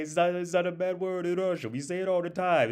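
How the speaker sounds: high-pitched, baffled Russian protest; Russian accent. Retold or imitated. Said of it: "with the Russian accent".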